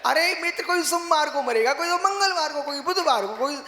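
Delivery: high, impassioned sermon voice; fiery preaching. Acted. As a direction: preach it at full force to the crowd. Preaching at full force. (जोशीला प्रवचन)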